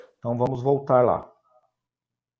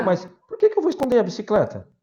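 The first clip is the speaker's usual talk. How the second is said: confused foreigner; 7 semitones higher, faster than usual. Pretend. Foreigner confused in role-play.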